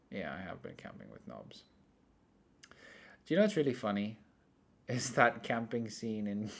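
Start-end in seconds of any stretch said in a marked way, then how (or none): 0.1-1.6 much softer, lower repeat of the admission; muttered aside. Real, not acted. Confirming to a friend he camped with knobs, muttered low. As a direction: mutter it again under the breath.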